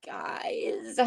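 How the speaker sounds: whispered, almost breathless; hushed whisper. Real, not acted. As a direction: drop to a whisper for the shocked aside. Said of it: "oh my God".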